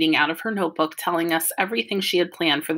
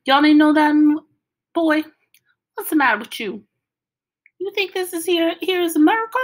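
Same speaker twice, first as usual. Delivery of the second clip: hammed-up Southern hillbilly accent, much higher and louder; Southern style voice; 9 semitones higher, 5 dB louder than usual. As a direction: go big with a mock Southern hillbilly accent, high and loud. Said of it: "say some more Southern style stuff".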